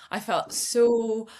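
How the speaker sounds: ordinary delivery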